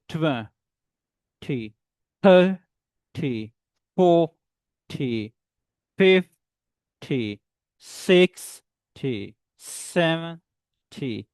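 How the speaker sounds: TWENTY to SEVENTY split, strong first syllable, pause, light TY; first syllable stressed. Shown as a demonstration. Teacher drills learners "like this", split front stress. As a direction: punch the first half, wait, then drop TY. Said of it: "stress the word third".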